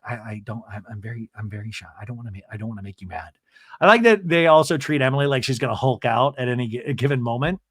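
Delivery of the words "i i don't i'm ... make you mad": much softer, lower, rushed; mock timid Shannon. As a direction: shrink away nervously.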